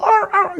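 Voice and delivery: very high squawking calls; two high imitated bird cries; turkey squawk. Turkey showing off at the top, high squawks.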